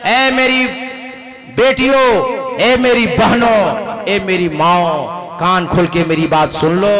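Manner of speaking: slow booming sermon voice; preaching address. Acted. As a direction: summon the congregation, drawn out.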